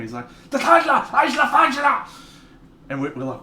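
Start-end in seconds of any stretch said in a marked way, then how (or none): 0.5-2.1 loud, high, crazed German scream. Retold or imitated. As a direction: scream it out like a wild drunk German, drawn out. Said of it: "he screams something out in Ger-, in German".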